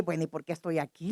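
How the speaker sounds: low, soft puzzled question; re-enacted bewilderment, voice dropped; retold confusion. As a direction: ask it quickly and low, as if disoriented. Retells not knowing why hospitalised.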